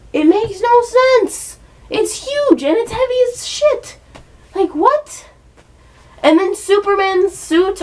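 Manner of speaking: shrill, exasperated ranting, fading to a whimper; squealing outraged rant. Real, not acted. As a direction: rant in high-pitched disbelief. Ranting about the suits.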